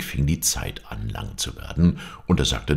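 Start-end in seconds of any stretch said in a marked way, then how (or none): none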